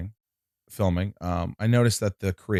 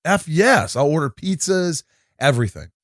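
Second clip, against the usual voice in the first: a touch higher and louder, eager; excited yes; 7 semitones higher, 3 dB louder than usual. Retold or imitated. Agreeing to host the cast screening, eagerly. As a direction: jump at the offer like a kid. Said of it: "So I go".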